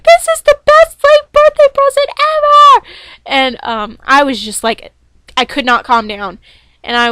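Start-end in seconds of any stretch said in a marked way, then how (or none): 0.0-2.9 much higher and louder, shrieking with joy; ecstatic squeal. Retold or imitated. Fan reliving the title win, thrilled squealing. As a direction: squeal it like the best gift ever. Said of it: "so I was just like".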